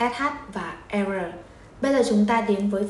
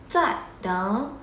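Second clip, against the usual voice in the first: normal talking voice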